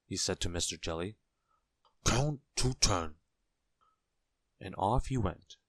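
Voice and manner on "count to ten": slow, emphatic tramp voice, pitched up; kindly tramp. A narrator's reading. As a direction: space out the advice so it sticks. Tramp's parting advice.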